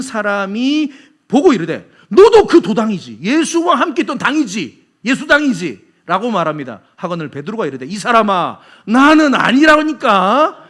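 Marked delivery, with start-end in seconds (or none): 2.1-5.9 raised, higher voice pointing the finger; loud accusation. Acted. Bystander accusing Peter of being with Jesus, loud. 7.9-10.6 loud, drawn-out protest; indignant denial. Acted. Peter denying it to his accuser, indignant.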